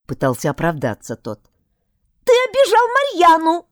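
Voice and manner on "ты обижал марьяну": tiny high squeaky voice; pixie. Acted. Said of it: "слабо пропищал Пикси". (писклявый голосок пикси)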